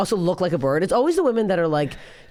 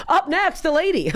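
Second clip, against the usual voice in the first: high, sneering announcer voice; mock dismissive host; 10 semitones higher than usual. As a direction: imitate the host brushing her off. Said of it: "and I got".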